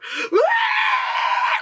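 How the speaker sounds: high hammed-up shriek; badly acted freak-out wail; mock manic scream. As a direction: scream like an overacting actress. Demonstrates the fake manic episode.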